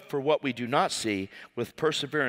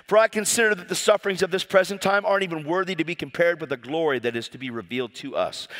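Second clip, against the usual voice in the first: usual speaking voice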